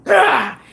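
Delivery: high imitated "ah"; imitated yell. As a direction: act out the kid's sudden high cry. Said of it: "he was like".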